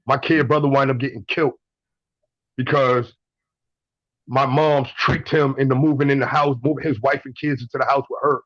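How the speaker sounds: ordinary talk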